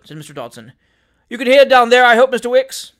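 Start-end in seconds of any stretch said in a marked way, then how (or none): 1.3-2.9 loud, high shout to someone below; raised, carrying call; calling downstairs. A narrator's reading. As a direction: project it down the stairwell. Calling down stairs to clerk.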